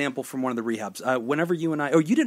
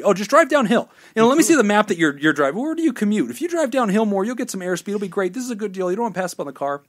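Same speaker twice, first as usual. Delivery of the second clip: higher and louder, a hammed-up fast-talking dealer; slick salesman pitch; 6 semitones higher, 5 dB louder than usual. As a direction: oversell it like a used car guy. Dan the salesman pitches the car.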